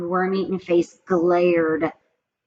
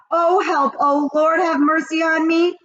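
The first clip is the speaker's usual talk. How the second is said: panicked; 8 semitones higher, 4 dB louder than usual. A narrator's reading. Boy panics among witches.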